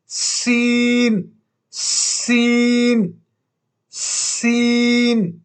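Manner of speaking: سین with a long hissed س like a whistle; whistling sin. Shown as a demonstration. Teacher showing students the letter, سین hissed like a whistle. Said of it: "سیٹی کی طرح".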